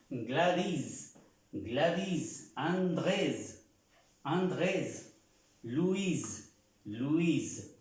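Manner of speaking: "Gladys", "Andrés", "Luis" stressed on the last syllable, S sounded; S final y acento final. Shown as a demonstration. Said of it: "las S se pronuncian haciendo un acento". ("Gladys", "Andrés", "Luis" con acento en la última sílaba y S sonora)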